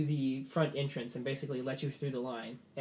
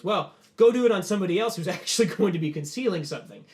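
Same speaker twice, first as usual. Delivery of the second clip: raised, forceful officer's rebuke; high, loud scolding with a laugh in it; loud dressing-down; 3 semitones higher, 8 dB louder than usual. Acted. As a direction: put the soldier in his place, loudly. General scolds the soldier.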